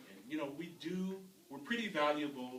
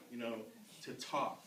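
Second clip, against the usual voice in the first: ordinary talk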